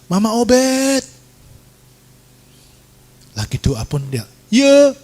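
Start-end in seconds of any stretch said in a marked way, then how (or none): 0.1-1.0 high, sustained call. Retold or imitated. Neighbour calls the mother from back door, high sing-out call. 4.5-4.9 high, loud answer; calling back. Retold or imitated. Praying mother answers the visitor, loud high call.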